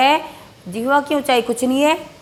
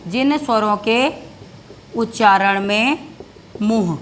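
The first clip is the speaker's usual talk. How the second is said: slow dictation with long pauses; dictation pace. Shown as a demonstration. Teacher dictates to students writing notes, slowly. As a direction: dictate slowly, leaving time to write. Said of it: "तो लिखते हुए चलिए".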